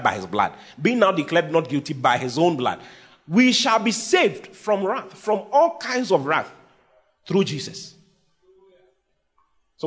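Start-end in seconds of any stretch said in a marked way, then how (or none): 3.3-6.5 higher and slow, each word weighted; preacher's slow triumphant declaration; slow emphatic proclamation. Acted. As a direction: proclaim it slowly, lifting "saved". Preacher climaxing on promise.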